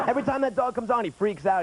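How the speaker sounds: ordinary delivery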